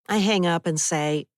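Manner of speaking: plain reading voice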